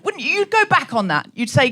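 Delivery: normal talking voice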